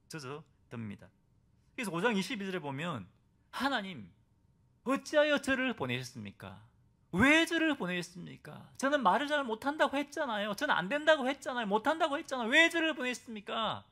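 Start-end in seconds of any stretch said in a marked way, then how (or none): 3.5-13.8 loud, raised, aggrieved protest at God; complaining to God. Acted. Moses loudly complaining to God, why send me. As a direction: plead and accuse, voice pushed high. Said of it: "이렇게 하나님께 막 원망합니다".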